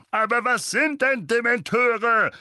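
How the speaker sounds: slow, high, loud testy question; annoyed uncle demands an answer. A narrator's reading. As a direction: demand it crossly. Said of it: "fragte Onkel Vernon fuchsig".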